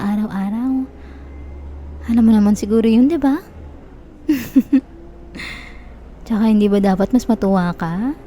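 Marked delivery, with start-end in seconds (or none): 4.3-4.8 a brief laugh dropping at the end; short laugh. Acted. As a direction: laugh it off lightly. Laughs after her confession. (maikling tawa)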